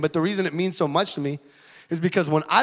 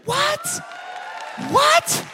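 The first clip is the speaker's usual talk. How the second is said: very high, stunned cry; two quick stunned shouts of what; shocked. Real, not acted. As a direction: cry out in disbelief. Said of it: "gasps at news of another kid".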